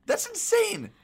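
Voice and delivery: high, loud disbelief; shocked. Real, not acted. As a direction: cry out in disbelief. Shocked at VCR sales.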